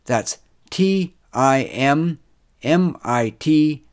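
loud, measured closing credits read; projected, careful outro voice; podcast announcer sign-off. Acted. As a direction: announce it deliberately, spacing out the web address.

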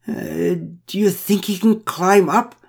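high, slow, nervous quaver. A narrator's reading. Woman on the platform fears the bear, quavering. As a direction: ask it timidly, voice wobbling up high. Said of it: "with a quavering voice".

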